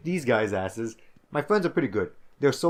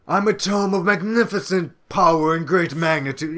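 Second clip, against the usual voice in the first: pompous, snooty, higher and louder; booming haughty talking book; Grimoire Weiss; 6 semitones higher, 8 dB louder than usual. Retold or imitated. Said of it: "that's how he sounds".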